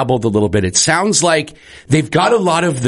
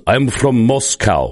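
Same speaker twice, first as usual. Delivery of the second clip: fake Russian accent; 3 semitones lower, slower than usual. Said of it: "a guy is claiming to be Russian".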